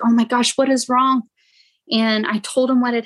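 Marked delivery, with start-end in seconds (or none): 0.0-1.2 raised, alarmed neighbor asking what's wrong. Retold or imitated. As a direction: mimic concerned neighbors, pitched up. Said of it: "And they were like".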